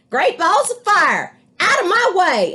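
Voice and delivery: very high, loud brash goose; goose hollering. A narrator's reading. Goose hollers at the others, high and loud. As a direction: barrel through like a goose.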